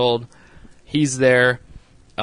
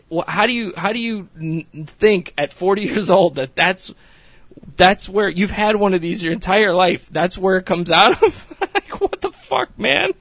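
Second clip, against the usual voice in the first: high, louder, building to a laugh; exasperated disbelief; 7 semitones higher, 4 dB louder than usual. Real, not acted. Vlogger marveling at the friend's ignorance, laughing. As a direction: rant in amused disbelief. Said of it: "all I'm sitting here thinking is".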